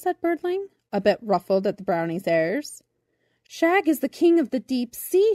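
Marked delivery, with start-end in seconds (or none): 3.5-5.3 higher, louder group shout; shouted together. A narrator's reading. Fairies shouting Shag's name to Birdling, loud and high. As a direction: shout it out all at once. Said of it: "shouted all the fairies together".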